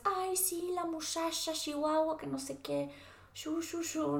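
high, taunting sing-song mockery; mocking classmates. Classmates teasing her at school, high mocking voice. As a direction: mimic the kids making fun of her accent. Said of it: "neta no me dejaron en paz".

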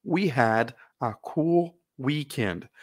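"We had a cool weekend" drawn out word by word; very slow model reading. Shown as a demonstration. Teacher modeling the sentence very slowly for students to repeat. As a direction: spell each word out slowly for the class to repeat. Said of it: "Leiam comigo".

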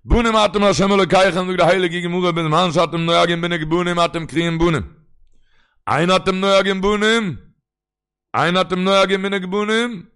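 loud, high declaimed Talmud quote; full-force preaching. Real, not acted. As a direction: proclaim the holy text at full voice. (דרשענען מיט א הויך קול)

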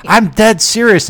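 drawn-out, emphatic mock threat; dead serious. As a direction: hammer it home as a retold warning. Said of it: "God, you sounded serious".

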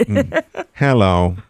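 lightly voiced talking-toy greeting; a hint of toy robot voice; Speak and Spell. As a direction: greet like an electronic learning toy. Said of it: "She got a speak and spell".

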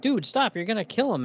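high, urgent retold plea to stop; alarmed plea. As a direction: cry out to make him stop, mock alarmed.

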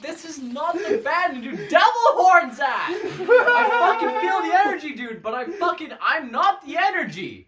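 high, loud and laughing; laughing exasperated rant. Retold or imitated. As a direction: protest through laughter, bright and loud. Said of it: "I'm like".

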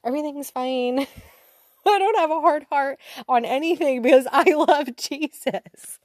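high, sing-song smug denial; mock self-righteous. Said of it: "so self-righteous at the time".

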